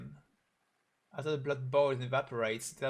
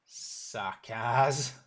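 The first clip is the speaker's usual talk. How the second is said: angry; 6 dB louder than usual. Acted. Spits out the enemy's name.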